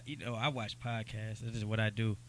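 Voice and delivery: ordinary delivery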